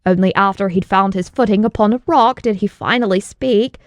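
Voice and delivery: ordinary delivery, nothing marked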